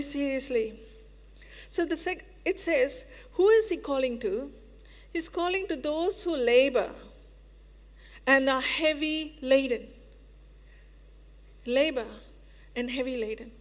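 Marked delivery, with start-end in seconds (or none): none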